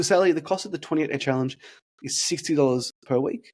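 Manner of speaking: ordinary talk, nothing marked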